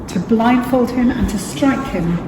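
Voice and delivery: ordinary talk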